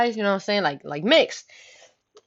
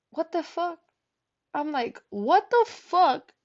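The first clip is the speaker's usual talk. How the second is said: shocked; 9 semitones higher, slower than usual. Retold or imitated.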